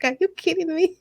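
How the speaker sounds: high-pitched incredulous reaction; retold disbelief. Her reacting to the coach's compliment, high retold disbelief. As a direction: replay your disbelief in a high voice.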